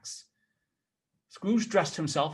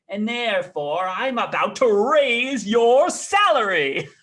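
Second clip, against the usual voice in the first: loud, slow, higher laughing announcement; laughing reveal; 6 semitones higher, 6 dB louder, slower than usual. Acted. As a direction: burst out with the good news laughing. Scrooge reveals raise laughing.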